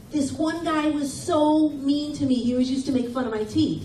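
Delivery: normal talking voice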